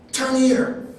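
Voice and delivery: sudden call. Retold or imitated. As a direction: blurt it out like a wife spotting the store.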